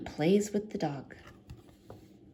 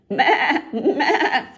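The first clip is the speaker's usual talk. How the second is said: higher, loud bleating meh; goat bleat; 7 semitones higher, 9 dB louder than usual. A narrator's reading. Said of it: "says the goat".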